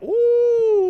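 high, loud, drawn-out whoop; drawn-out ooh. Retold or imitated. Reacting to her bar, high drawn-out whoop. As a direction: let out a long, high "ooh".

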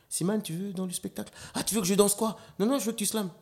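high-pitched retold back-and-forth; acted-out booking exchange. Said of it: "des fois, ils me commandent". (échange rejoué, voix haut perchée)

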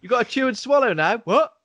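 high, loud nagging parent; scolding parent. Pretend. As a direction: tell him off like a mum. Scolding the endless eater.

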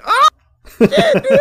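very high, loud squeal of excitement; excited squeal. Retold or imitated. Fan reliving the villain's line, squealing high. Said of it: "I was like".

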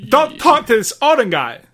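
actor impression starting high, then dropping; Christopher Walken. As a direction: give a warning as the celebrity playing Kratos. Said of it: "Christopher Walken doing his version of, uh, Kratos".

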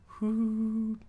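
a light, hooting call; gentle harmonica hoot. Retold or imitated. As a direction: breathe a gentle hoot at the bear. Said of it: "blow it ever so gently and just go".

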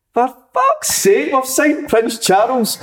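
mocking jibe. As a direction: rib the new boy about his posh clothes. Teammate mocks new signing's outfit.

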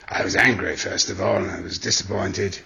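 low measured interpreter voice; deliberate, heavy manager tone; Sarri's interpreter impression. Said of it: "Sari's interpreter".

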